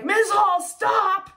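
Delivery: imitating a pupil's high-pitched plea to stop; students protesting. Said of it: "other students were saying things like".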